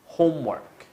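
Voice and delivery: homework with stress on home and a falling end; model pronunciation. Shown as a demonstration. Teacher modelling homework for students to repeat. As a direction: model homework clearly for repetition. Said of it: "And again".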